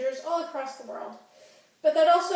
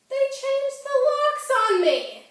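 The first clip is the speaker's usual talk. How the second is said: high, slow, drawn-out indignant cry; aggrieved high-pitched complaint; outraged wail; 12 semitones higher, 3 dB louder than usual. Acted. Acted outrage at being locked out.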